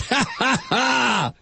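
real high-pitched laughter; mocking laugh. Real, not acted. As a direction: burst out laughing at the absurdity. Laughs at his own mockery.

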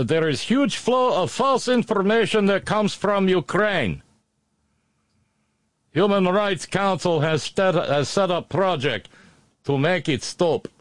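high, mocking Russian accent voice. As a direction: sneer it in a thick Russian accent. Quotes a Russian official again.

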